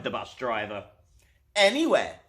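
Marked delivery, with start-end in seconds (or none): 1.5-2.2 bright, upbeat "Anywhere" with a falling end; cheerfully, as if any place will do. A narrator's reading. Driver cheerfully answers the man in the red tie, upbeat. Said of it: "he replied cheerfully".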